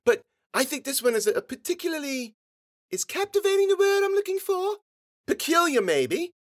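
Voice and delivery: put-on very high, wordy child's voice; precocious little girl. A narrator's reading. Girl hunting for a fancy word, high and precocious. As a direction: search for the word as a small girl.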